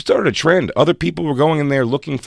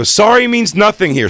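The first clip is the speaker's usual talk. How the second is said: higher and louder staged scolding; mock angry shout; 9 semitones higher, 5 dB louder than usual. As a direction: slap down his apology. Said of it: "I go".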